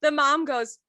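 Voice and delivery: ordinary delivery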